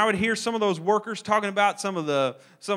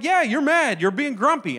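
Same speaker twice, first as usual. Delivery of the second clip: mock insistence; 6 semitones higher, 5 dB louder than usual. Family insisting he's grumpy.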